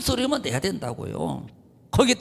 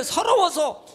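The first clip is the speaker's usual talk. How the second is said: preaching shout; 8 semitones higher, slower than usual. Real, not acted. Preacher's climax.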